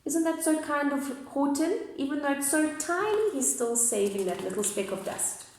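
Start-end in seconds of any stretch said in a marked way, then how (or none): none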